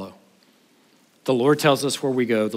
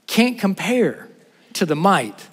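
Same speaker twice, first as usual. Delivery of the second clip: high, slow weighty words; slow emphatic; 4 semitones higher, slower than usual. Acted. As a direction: stretch it out for weight. Preacher stresses God's might.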